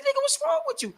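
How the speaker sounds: very high mocking girlfriend voice; high-pitched girlfriend impression; quoting his girl. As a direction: squeak it like his teasing girl. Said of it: "my girl's like".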